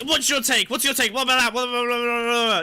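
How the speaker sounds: loud, high, rapid-fire questions; mock pestering. Boss hammering a worker for opinions, loud and pushy. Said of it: "get like".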